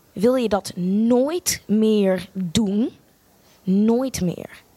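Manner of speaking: very slow, drawn-out mock warning; stern director. Director Gert Thijs telling her never to skip again, slowly and sternly. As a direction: lay it down slowly like a cold reprimand. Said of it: "terwijl hij heel streng was".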